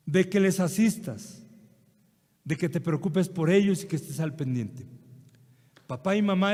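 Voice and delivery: fervent sermon appeal to honour parents; full-force preaching. Acted. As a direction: drive the point home to the flock. Preacher exhorting the congregation.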